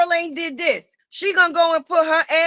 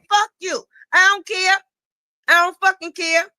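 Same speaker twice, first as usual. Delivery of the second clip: defiant yell; 6 semitones higher, 3 dB louder than usual. Real, not acted. Defiant rant at critic.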